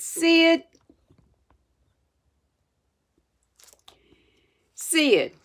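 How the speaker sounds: high, sharp call of a name; aggravated call. A narrator's reading. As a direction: call out to rouse a sleeper. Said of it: "Tom was aggravated".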